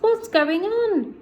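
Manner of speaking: high, slow, puzzled chorus of animals. Acted. Farm animals puzzled, asking what's going on. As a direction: ask it high and slow, baffled. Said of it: "the animals asked".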